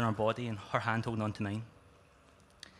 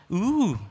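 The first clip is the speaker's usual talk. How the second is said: high loud mocking ooh; a high, loud teasing ooh; friend's mock-shocked ooh at a rude remark; 10 semitones higher, 7 dB louder than usual. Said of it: "goes Tommy".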